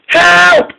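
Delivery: high, loud panicked shout; cry for help. A narrator's reading. Derailed Boko shouting to Mike for help. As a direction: scream it as you derail. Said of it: "he cried".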